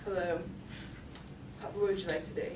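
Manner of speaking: low, quiet, lifeless customer-service voice; deadpan server. Retold or imitated. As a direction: greet a customer with zero enthusiasm. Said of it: "his voice is, His tone never changes".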